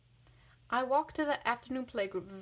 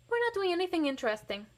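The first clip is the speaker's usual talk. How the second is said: fast, much higher hurried brush-off; quickly; 9 semitones higher, 4 dB louder, faster than usual. A narrator's reading. Else Marie hurrying her daddies away, fast and high. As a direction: rush to get away. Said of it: "I say quickly".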